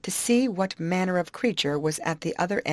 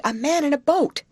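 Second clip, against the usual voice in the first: high, louder exclamation; excited cry; 10 semitones higher, 6 dB louder than usual. Acted. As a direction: cry out the sighting to your friends. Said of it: "she cried".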